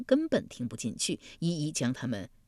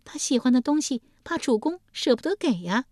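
teasing; 8 semitones higher, 3 dB louder than usual. Acted. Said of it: "丽姬笑嘻嘻说".

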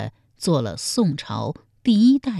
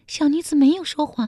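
higher, frightened maid pleading; pale frightened maid; 12 semitones higher, faster than usual. A narrator's reading. Maid pleading with the angry emperor, higher frightened voice. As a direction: plead your innocence, scared. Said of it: "宫女面如涂蜡说".

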